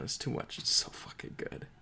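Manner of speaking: soft, breathless whisper of awe; awed whisper. Real, not acted. Reviewer still reeling from a moving scene, whispering in awe. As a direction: whisper it as if the scene is too much to bear. Overcome after favourite scene.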